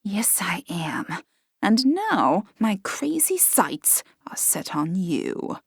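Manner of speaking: slow, menacing whisper. A narrator's reading. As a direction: whisper a threat to her. Henrietta threatening the barmaid.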